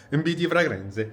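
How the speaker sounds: sing-song hammed-up Italian pronunciation; Italian accent. Vlogger repeats the fair's name after laughing, playful Italian accent. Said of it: "J'essaye de parler italien".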